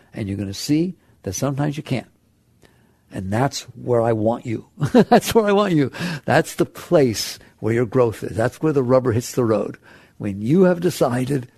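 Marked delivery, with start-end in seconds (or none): 4.9-5.9 a laugh, then lifted and brighter. Real, not acted. Teacher repeats the point to the class, laughing.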